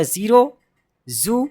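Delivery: ज़ीरो, जू with a buzzing z; correct Z sound. Shown as a demonstration. Teacher showing the letter Z, buzzing z. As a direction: say ज़ीरो, जू as "zero, zoo". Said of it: "तो जेड का".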